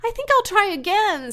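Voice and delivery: high, loud cheerful pig. Acted. Wilbur telling Charlotte he'll try again, cheerful. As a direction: bounce back brightly. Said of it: "said Wilbur cheerfully".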